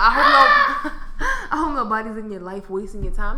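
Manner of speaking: laughing mock. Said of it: "They talk about".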